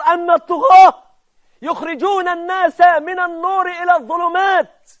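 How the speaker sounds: loud, emphatic pulpit oratory; full-force preaching. Real, not acted. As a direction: proclaim it with rising fervour.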